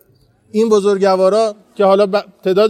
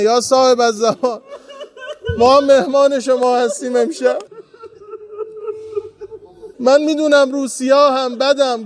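tearful prayer; 5 semitones higher than usual. Real, not acted. Tearful plea at the shrine.

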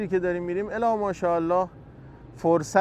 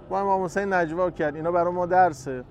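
normal talking voice

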